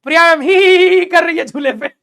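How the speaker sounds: high, loud imitation of her giggling; hammed-up giggling imitation; mock giggle. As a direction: tease her by aping her hee-hee laugh. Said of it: "ही करके हंस रही है".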